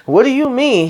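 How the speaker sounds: high, incredulous question; acted disbelief. Richard questioning the leader's threat, imitated high disbelief. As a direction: pitch it up in mock bafflement.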